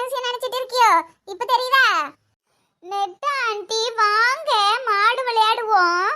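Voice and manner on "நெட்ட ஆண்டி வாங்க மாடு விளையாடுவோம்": put-on slow singsong kid invitation; five-year-old child. Child inviting auntie to play cows, childlike. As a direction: draw out each word like a small child. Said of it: "அஞ்சு வயசு குழந்தைதான்".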